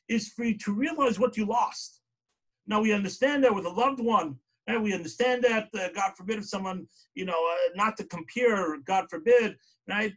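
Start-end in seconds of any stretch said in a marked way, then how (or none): none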